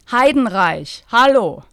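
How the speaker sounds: curt, loud, dry; dry rebuff. Acted. Bettina refusing Rosi's Du, dry and curt. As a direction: shut her down dryly. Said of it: "ließ Bettina sie trocken auflaufen".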